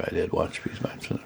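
whispered, choking on words; choked up. Real, not acted. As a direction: voice catching with emotion. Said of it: "I can't even say it".

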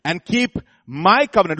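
strong emphasis on "my" before "covenant"; stress on MY. Real, not acted. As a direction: lean hard on the word "my". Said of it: "the emphasis on the word my".

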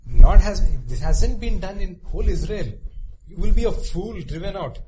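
loud pleading appeal; Tamar's last resort plea. Retold or imitated. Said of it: "that Tamar is saying".

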